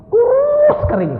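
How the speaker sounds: very high, drawn-out, loud; exaggerated squeal. Preacher exaggerates how skinny he stayed, squealing high and slow. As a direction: stretch it into a hammed-up squeal.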